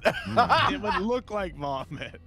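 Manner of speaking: laughing through the words. Real, not acted. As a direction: crack up mid-sentence. Laughs mid-sentence.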